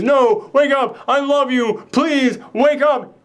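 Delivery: high, loud pleading cry to wake her; frantic high plea, drawn out slowly on the last; desperate pleading. Retold or imitated. Man begging his sleeping lover to wake up, high desperate cry.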